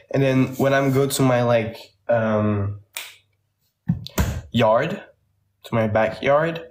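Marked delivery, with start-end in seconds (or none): none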